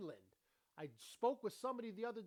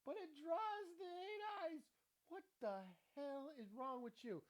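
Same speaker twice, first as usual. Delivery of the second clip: mock baffled voice; 10 semitones higher than usual. Reacting to something odd.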